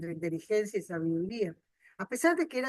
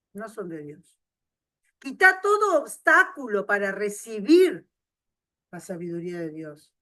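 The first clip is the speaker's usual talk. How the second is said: ordinary talk, nothing marked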